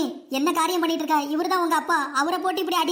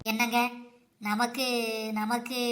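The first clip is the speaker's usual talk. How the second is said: much lower and softer, bashful halting start; shy hesitation; 6 semitones lower, 5 dB quieter, much slower than usual. Acted. Wife shyly hinting at news to her husband: bashful soft hesitation. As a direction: trail off shyly, too embarrassed to say it.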